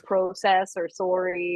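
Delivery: "process" and "sorry" with long Canadian O sounds; Canadian pronunciation. Shown as a demonstration. Showing what she thought was no accent, "process or sorry" said the Canadian way. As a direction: say "process" as PROH-cess and "sorry" as SORE-ee, slowly. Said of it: "this is what I thought was no accent".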